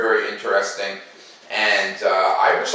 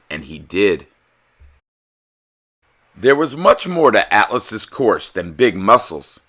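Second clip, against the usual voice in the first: put-on lively, louder read-aloud for kids; animated storybook narrator; story narration; 5 dB louder than usual. A narrator's reading. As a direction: read it like a bedtime picture book. Reads picture book aloud.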